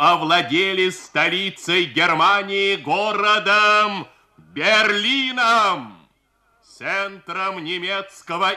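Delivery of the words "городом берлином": городом Берлином drawn out high and slow; slow triumphant emphasis. Acted. Climax of the victory announcement.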